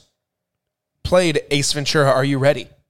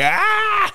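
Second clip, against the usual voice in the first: high scream. Retold or imitated. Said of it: "He's doing the Kevin Hart thing".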